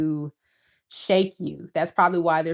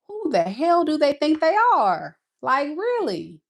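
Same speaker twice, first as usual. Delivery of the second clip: incredulous scoff; 9 semitones higher than usual. Retold or imitated. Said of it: "you're like".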